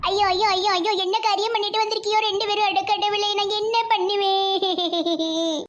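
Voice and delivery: hammed-up lament ending in a long wail; theatrical wailing. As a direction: wail in shock and stretch the last word. Mother sees son's surprise marriage.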